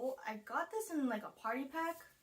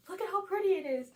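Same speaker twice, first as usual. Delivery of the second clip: excited; 9 semitones higher, 6 dB louder, faster than usual. Real, not acted. Admiring lipstick.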